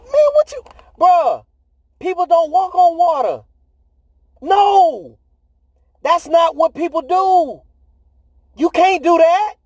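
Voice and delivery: high, incredulous mock voice of the disciples; hammed-up high disbelieving disciples; disciples in disbelief. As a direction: squeak out the disbelief like a stunned friend. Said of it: "Them disciples like".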